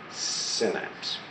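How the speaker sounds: "synapse" starting like sin, with a short i. Shown as a demonstration. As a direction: model "synapse" as SIN-apse. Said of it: "This makes it synapse".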